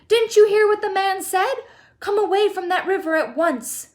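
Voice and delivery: high, loud scolding mother; calling out. A narrator's reading. Mother ordering son from the river, scolding call. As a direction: order the boy away sharply. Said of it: "called out Missus Gloop".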